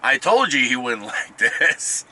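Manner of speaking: laughing through it, slow; chuckling as the dog talks. Retold or imitated. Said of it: "he was telling him".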